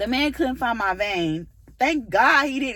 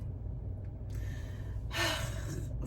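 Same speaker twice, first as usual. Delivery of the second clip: breathy sigh; 8 semitones higher, 13 dB quieter than usual. Real, not acted. Embarrassed over crying.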